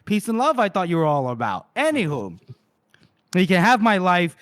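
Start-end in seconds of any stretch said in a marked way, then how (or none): 0.0-1.7 much higher, playful ribbing; high, jokey voice pretending to scold a pal; mock teasing voice. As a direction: tease him like a cheeky buddy. Voicing a teasing friend.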